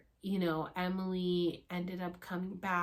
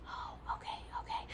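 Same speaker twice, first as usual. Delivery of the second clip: whisper; 10 dB quieter than usual. Retold or imitated. Shushed, others still in process.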